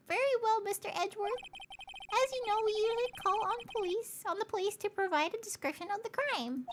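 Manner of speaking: squeaky high judge voice; high, formal judge; high-pitched judge. Acted.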